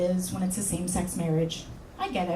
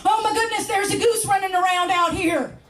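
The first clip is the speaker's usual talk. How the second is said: put-on much higher, panicked old man's voice; frantic caller; 8 semitones higher than usual. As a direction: yelp it out like a man chasing a goose. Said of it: "completely frantic".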